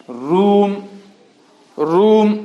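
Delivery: "रूम" with the OO held long. Shown as a demonstration. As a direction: stretch the vowel in "रूम". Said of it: "long sound ऊ का". ("रूम" में लंबा ऊ)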